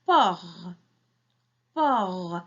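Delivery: "por" isolated and drawn out, with the r held; first syllable alone. Shown as a demonstration. Teacher isolates the first syllable, drawn out. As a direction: say "por" by itself, long and falling. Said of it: "la première syllabe".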